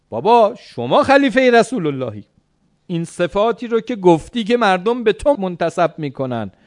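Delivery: high, loud disbelieving objection; astonished protest. Acted. Acted astonished son protests to father.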